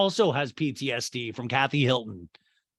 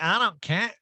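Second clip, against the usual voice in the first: hammed-up retort; mock character voice; 7 semitones higher than usual. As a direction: toss it off in a cartoonish put-on voice. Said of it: "And we're like".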